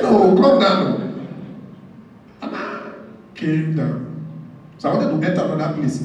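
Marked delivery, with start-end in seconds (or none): 0.0-1.3 loud, slow, drawn-out no, come down; fed-up usher calling up the stairs; exasperated call. Retold or imitated. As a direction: call him down impatiently. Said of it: "He said".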